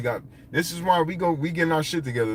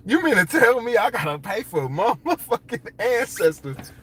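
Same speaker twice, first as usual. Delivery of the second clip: higher, laughing outrage; indignant disbelief; 8 semitones higher than usual. Retold or imitated. Tourist scoffing at hustlers charging for ancestors, higher laughing disbelief. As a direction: scoff at paying to see ancestors.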